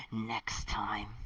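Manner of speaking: a menacing whisper. Acted. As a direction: breathe the threat out without voice. Repeated threat, second take whispered.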